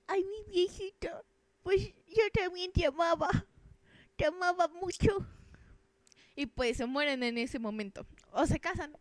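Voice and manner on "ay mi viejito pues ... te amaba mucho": put-on high, sweet little old lady voice; old woman voice. Old wife answering her husband, high doting old lady. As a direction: coo it like a tender old grandma.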